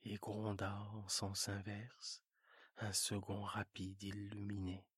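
quiet narrator voice; low narration. A narrator's reading. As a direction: tell it low and hushed.

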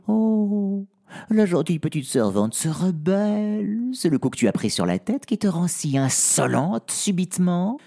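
high, mocking spoiled princess; sneering high royal voice; haughty princess. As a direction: talk down to the servant from above. Princess mocks the servant.

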